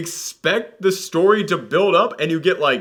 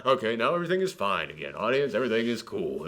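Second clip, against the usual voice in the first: low, soft all-clear; mock reassurance; 7 semitones lower, 8 dB quieter than usual. Film calming its audience after another scare, mock-soothing. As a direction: settle them down after a fright.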